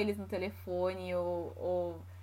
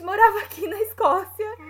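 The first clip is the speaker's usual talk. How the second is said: much higher and louder, bursting out; excited; 12 semitones higher, 11 dB louder than usual. Real, not acted. Woman telling how her boyfriend lived in Scotland, excited. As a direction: let the coincidence pop out loud and high. Surprising coincidence in her story.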